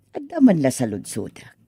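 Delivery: ordinary delivery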